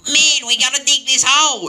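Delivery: hammed-up hole-digging crew; workmen's voice. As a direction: bark it like a squeaky digger.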